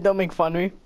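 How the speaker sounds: ordinary talk, nothing marked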